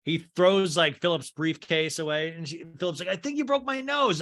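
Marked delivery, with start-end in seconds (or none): 3.1-4.2 high-pitched aggrieved complaint; aggrieved protest. Acted. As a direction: protest in a raised, wounded voice. Philip whining at Edward in acted quarrel.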